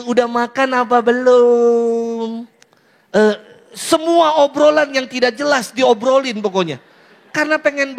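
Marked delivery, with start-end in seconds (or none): 0.1-2.5 slow, drawn-out question with a stretched last word; mock lovey-dovey. Lovers making small talk by phone, mock sweet. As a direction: stretch it out, half-crooned.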